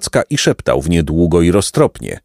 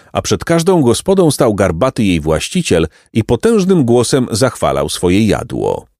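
ordinary delivery, nothing marked

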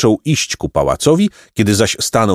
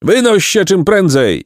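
high, rejecting crowd cry; indignant high-pitched mob call; shout; 10 semitones higher than usual. A narrator's reading. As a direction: drive him out with a raised shout. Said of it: "krzyknęli nagle wierni dotąd poddani".

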